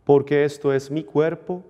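measured priestly recitation; formal liturgical prayer. Acted. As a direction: intone it like a priest at mass.

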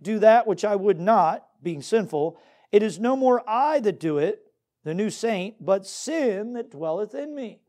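ordinary talk, nothing marked

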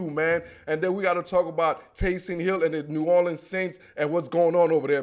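usual speaking voice

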